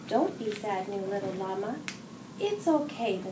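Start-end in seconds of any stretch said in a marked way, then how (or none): none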